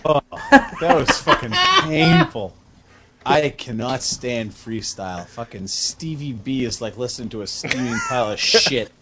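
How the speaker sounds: a lightly put-on Boston accent, grumbling; a Revere Beach guy with a light Boston accent, griping about freestyle; mock Boston accent. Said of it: "who the fuck talks like that?".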